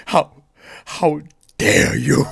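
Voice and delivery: slow, high, loud hammed-up indignation; mock outrage. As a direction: ham up the wounded friend. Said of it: "It's like".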